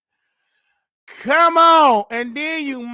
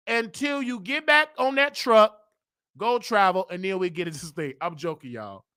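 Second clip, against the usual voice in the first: ordinary delivery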